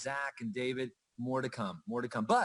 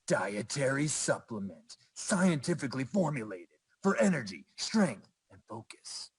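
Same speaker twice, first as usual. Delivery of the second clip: movie trailer voice. Shown as a demonstration. Presenter reading a product label for fun, gravelly movie trailer voice. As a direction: growl it like a movie trailer announcer. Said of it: "read it with that gravelly voice".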